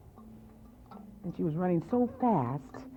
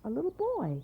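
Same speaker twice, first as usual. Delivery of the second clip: plain reading voice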